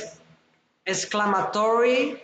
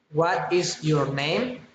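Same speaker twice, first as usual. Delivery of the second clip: NAME rising at the end; friendly rising question; 3 semitones lower than usual. Shown as a demonstration. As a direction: ask politely, lifting the pitch on "name". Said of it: "Friendly interest, politeness, rising intonation".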